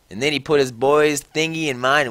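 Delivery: ordinary delivery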